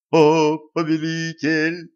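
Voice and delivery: drawn-out, sung-out wail; wailing servant. A narrator's reading. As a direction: cry it out like a devoted cultist. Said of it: "возопил бледный человечек". (протяжный вопль слуги)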